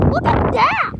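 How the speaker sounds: high, loud burst of excitement; excited exclaim. Real, not acted. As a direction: shout it out, thrilled. Storm gust, excited exclaim.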